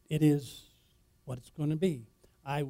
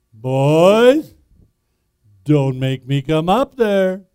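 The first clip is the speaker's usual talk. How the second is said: father's shout up stairs; 15 dB louder, much slower than usual. Retold or imitated. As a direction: boom it up the stairway like a stern dad. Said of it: "the voice came up again".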